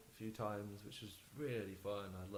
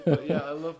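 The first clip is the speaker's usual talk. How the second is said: laughing; 6 semitones higher, 19 dB louder than usual. Real, not acted. Laughing after calling himself atheist.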